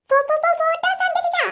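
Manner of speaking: put-on a young pupil stammering through an answer; stammering kid.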